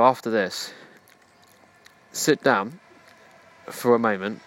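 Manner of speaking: ordinary talk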